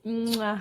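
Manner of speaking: smooch sound to listeners; blowing kisses. Real, not acted.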